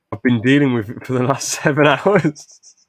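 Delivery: loud hammed-up rant breaking into laughter; laughing outburst. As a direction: blurt it out loudly, cracking up midway. Acted outburst, cracking up.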